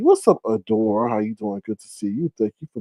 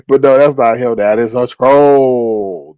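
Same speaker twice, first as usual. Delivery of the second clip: slow and drawn out; 2 semitones lower, 6 dB louder, slower than usual. Real, not acted. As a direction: drag each word out slowly. Correcting the troll's fake picture.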